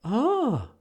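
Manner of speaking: high, short "ah"; mock startled intake; imitated gasp. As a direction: mimic her sudden high gasp. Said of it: "she's like".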